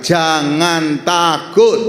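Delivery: slow, loud, drawn-out "Jangan takut"; solemn emphatic command. Acted. Quoting God's command dramatically.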